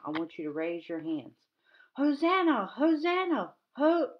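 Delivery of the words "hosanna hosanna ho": high, loud joyful cry of praise; shouting Hosanna. Shown as a demonstration. Teacher leading kids in a story, joyful loud shout. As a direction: call it out for the kids to answer. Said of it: "every time I say Hosanna".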